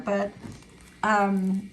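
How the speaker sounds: ordinary delivery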